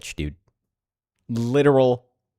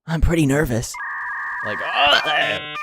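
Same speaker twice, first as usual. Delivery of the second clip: mocking imitation; 6 semitones higher, much slower than usual. Mocking the streamer's nervous line.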